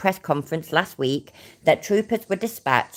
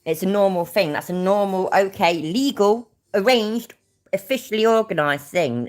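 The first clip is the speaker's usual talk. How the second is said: imitating his casual framing, pitched higher; mock matter-of-fact; 6 semitones higher than usual. Streamer mimicking how he calls street racing normal, mock matter-of-fact. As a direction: lay it out in mock-official terms, higher. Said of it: "he says it like".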